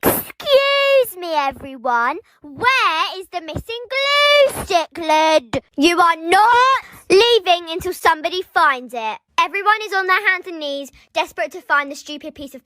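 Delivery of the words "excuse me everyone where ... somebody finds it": slow, hammed-up teacher announcement with stretched words; primary school teacher. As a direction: play an overdramatic teacher addressing the class. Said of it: "She would say".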